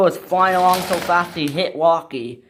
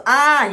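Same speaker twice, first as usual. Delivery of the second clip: high, loud "ah" of alarm; scream; 10 semitones higher, 6 dB louder than usual. Acted. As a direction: yelp as if derailing. Hugo coming off the rails.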